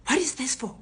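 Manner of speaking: high, sharp robber's demand; demanding retold question. Robber questions her about cufflinks, demanding mock voice. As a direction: act out a puzzled interrogator.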